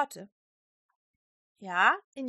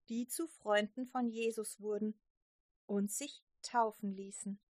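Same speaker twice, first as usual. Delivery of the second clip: ordinary delivery, nothing marked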